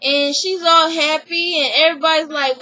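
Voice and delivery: ordinary delivery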